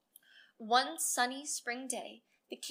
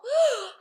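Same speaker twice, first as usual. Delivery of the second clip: loud gasp of horror; 12 semitones higher, 13 dB louder than usual. Acted. Guests react in horror.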